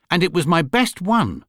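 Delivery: high, aggrieved insistence; wounded little bear's protest; indignant. A narrator's reading. Paddington's indignant parting shot.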